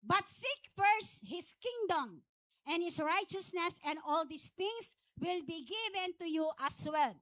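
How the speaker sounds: slow, solemn Bible reading; scripture reading. Acted. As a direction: read the verse slowly, like from the pulpit. Reads Bible verse aloud.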